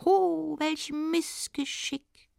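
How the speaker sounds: very high, slow dwarf voice; high-pitched, drawn-out dwarfish call; little smith. Acted. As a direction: draw it out in a high little goblin voice. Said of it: "sagte der kleine Schmied".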